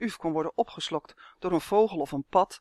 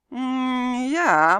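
drawn-out hum into a high, loud yes; reluctant, humming mumble; hesitant mumble; 7 semitones higher, 7 dB louder than usual. A narrator's reading. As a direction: hum doubtfully before admitting it. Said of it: "mompelde Tummy".

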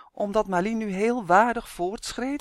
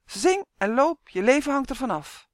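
loud, raised, urgent command; called out; 6 semitones higher, 5 dB louder than usual. A narrator's reading. Girl urges boy to keep marching, loud and urgent. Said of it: "riep Malien".

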